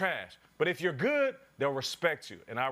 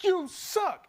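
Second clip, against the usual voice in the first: high mocking jeer; heckler taunt; 12 semitones higher, 3 dB louder than usual. Fan jeering at the receiver: high taunting jeer. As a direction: sneer it down from the stands.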